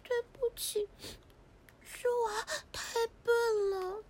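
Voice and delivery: soft, tearful apology. Acted. Girl apologising for her poor drawing, tearful and soft. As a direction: apologise quietly, close to crying. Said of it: "装什么哭啊？".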